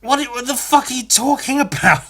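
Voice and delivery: exasperated disbelief; exasperated rant. Real, not acted. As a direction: vent the annoyance. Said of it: "I was so annoyed".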